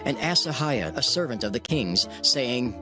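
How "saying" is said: ordinary talk, nothing marked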